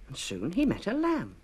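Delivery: usual speaking voice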